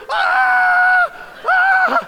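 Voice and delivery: two high, loud panicked screams of "Ah"; screaming. Retold or imitated. Retelling how the deer jumped up, high panicked screams. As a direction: scream in sudden terror as the buck leaps up. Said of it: "I scream".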